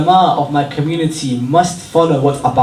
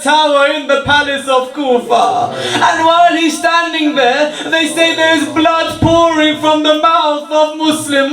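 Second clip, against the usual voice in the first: higher, impassioned mourning recitation with sobbing breaks; lamenting majlis recitation; 12 semitones higher, 4 dB louder than usual. Acted. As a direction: tell the tragedy as a grieving reciter, voice pitched up and near tears.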